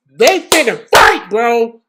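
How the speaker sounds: high, loud and slow hype shout; excited shout. Real, not acted. Fan shouting that they are about to fight. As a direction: drag it out in disbelief. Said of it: "I gotta stop screaming".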